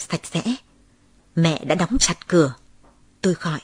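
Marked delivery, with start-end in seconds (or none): none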